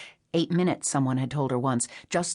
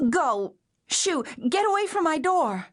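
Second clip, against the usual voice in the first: high panicked shout at the door; frightened shout; 12 semitones higher than usual. A narrator's reading. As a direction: shoo him off in fear. Terrified woman shouting at stranger.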